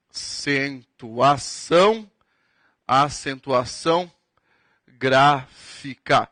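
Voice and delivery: very slow, spaced out for note-taking; dictating word by word; slow dictation. Shown as a demonstration. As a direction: spell it out slowly so they can write. Teacher repeats term for dictation.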